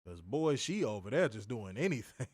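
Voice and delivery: ordinary talk, nothing marked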